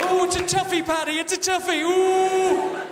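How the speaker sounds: high, loud mock agonising; agonising ditherer. Woman torn between desserts, hammed-up agonising. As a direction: wail over an impossible choice, hammed-up.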